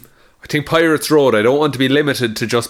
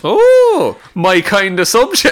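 mock eager; 7 semitones higher, 5 dB louder, slower than usual. Eager reaction to drugs topic.

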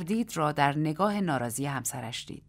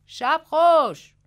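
high, drawn-out loud call; shouted farewell; 10 semitones higher, 11 dB louder, much slower than usual. A narrator's reading. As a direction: call out a loud goodnight, stretching it. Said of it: "مایک فریاد زد". (فریاد بلند و کشیده)

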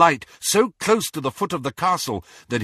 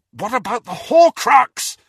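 higher, louder exasperated shout; 12 semitones higher, 8 dB louder than usual. A narrator's reading. Harry shouting at Ron and Hermione, exasperated. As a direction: snap them back to the task. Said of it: "Harry shouted".

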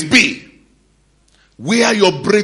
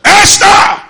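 high, loud call across the house; shouting a name; 14 semitones higher, 11 dB louder than usual. Acted. Mordecai calls Esther from the house, loud shout. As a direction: yell for her to come running.

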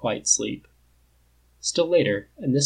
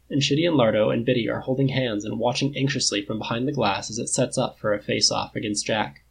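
ordinary delivery, nothing marked

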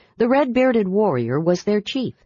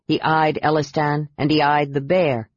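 ordinary delivery

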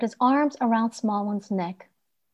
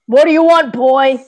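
gruff guard; 4 semitones higher, 9 dB louder than usual. Acted. Guard stops the boy at gates.

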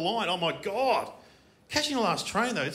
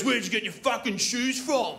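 higher, confrontational mock bogan voice; aggressive bogan; 5 semitones higher than usual. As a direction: pick a fight over someone's outfit. Acting a bogan confronting him.

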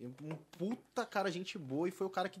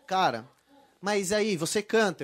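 voicing the therapist; 9 dB louder, slower than usual. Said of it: "Fono me falou assim".

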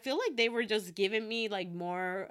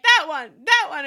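high, very loud excited imitation; mock fangirl outburst; mimicked eager fan; 5 semitones higher, 13 dB louder than usual. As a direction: pick out a favorite like an overexcited friend. Mimics Marianne pointing out favorite.

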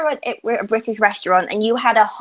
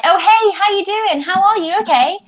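much higher, bright sing-song hello; breezy British greeting; 10 semitones higher than usual. Retold or imitated. Greeting a friend in the street, much higher and breezy. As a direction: toss off a quick street hello. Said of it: "I'm like".

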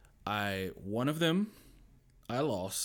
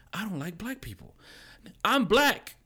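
mock accuser; 9 semitones higher, 6 dB louder than usual. Others' accusation voiced in story.

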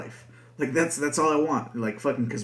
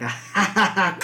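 bursting into loud laughter; gleeful laugh; 8 semitones higher, 5 dB louder than usual. Pretend. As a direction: cackle with glee. Imagining angrily hanging up.

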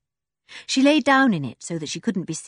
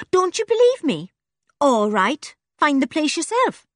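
higher, hurt snapping retort; indignant; 9 semitones higher, 4 dB louder than usual. A narrator's reading. Joe snapping at Dick for doubting her, higher and louder. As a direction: bristle at being doubted. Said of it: "She suddenly glared at Dick".

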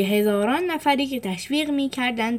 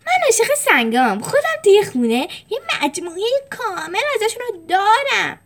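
much higher, louder odd voice; Felix; 12 semitones higher, 5 dB louder than usual. Acted. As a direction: gush about rocks like an eager nerd.

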